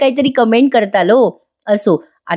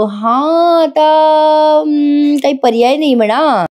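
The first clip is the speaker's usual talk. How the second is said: higher, drawn-out grudging concession; mock huffy; 5 semitones higher, slower than usual. Parth grudgingly forgives Kavya after puffing up, mock huffy. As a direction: give in with a hesitant, put-on huff. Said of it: "जरा नाकबीक फुगवतो तो आधी".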